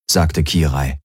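ordinary delivery

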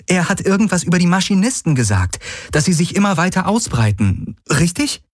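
high-pitched eager questioning; excited heroine. A narrator's reading. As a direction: check an exciting discovery, high and fast. Narrator voices the young heroine.

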